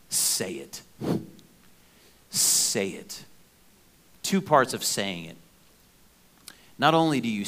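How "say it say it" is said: whispered urging. Shown as a demonstration. Pastor whispering the first step of prayer to the congregation, hushed. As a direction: drop to a breathy whisper, repeating the command. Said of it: "Number one".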